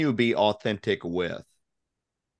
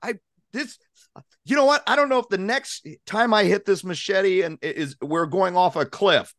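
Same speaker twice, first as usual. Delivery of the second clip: panicked inner voice; 12 semitones higher, 3 dB louder than usual. Retold or imitated. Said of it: "he says to himself".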